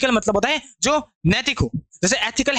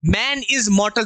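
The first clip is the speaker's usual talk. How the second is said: slow dictation; much slower than usual. Shown as a demonstration. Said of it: "example sentence dictated slowly".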